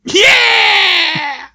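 high squeaky shout; helium voice. Acted. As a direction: yell it high like you sucked helium. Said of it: "are you snuffing helium".

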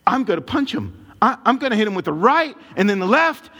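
worked-up threat, pitched high; retold anger. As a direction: retell a heated vow to fight. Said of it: "Anger takes control".

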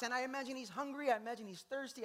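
usual speaking voice

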